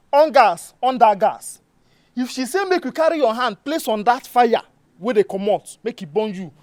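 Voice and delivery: heated, forceful admonishing; stern warning. Acted. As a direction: hammer each phrase home loudly. Stern warning, demonstrating with the fire.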